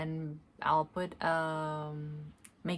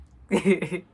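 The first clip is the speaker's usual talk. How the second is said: sudden loud real laugh; big joyful laugh; loud burst of laughter; 3 semitones higher, 15 dB louder than usual. Real, not acted.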